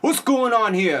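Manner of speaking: high, put-on cop voice; cop turning up. Cops derailing the story on arrival, put-on cop voice. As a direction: butt in as a mock policeman.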